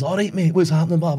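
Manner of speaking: lightly done cheery Scottish greeting; a light chirpy Scottish hello; a hint of broad Scottish. The shanty singer greets the player in light Scottish. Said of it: "and he's".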